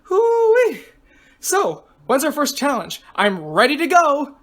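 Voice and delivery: high whoop into eager chatter; excitable girl contestant, raring to go. Acted. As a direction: whoop loudly, then push on eagerly. Voices excited contestant Grace.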